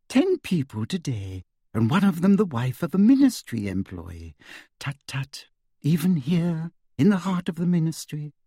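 sugary high-pitched official; sweet, simpering and high; Umbridge voice. A narrator's reading. Umbridge voice held.

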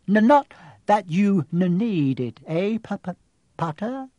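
high, stuttering nervous tease; nervous stammer. Acted. Quirrell joking nervously with Harry, stammering. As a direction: stutter through a timid joke.

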